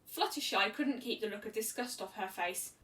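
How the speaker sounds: plain reading voice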